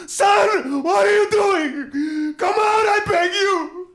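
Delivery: high, loud, pleading cry; desperate calling out. Acted. Creon calling into the cave to his son, desperate pleading shout. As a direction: call into the cave, begging. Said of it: "called out to him".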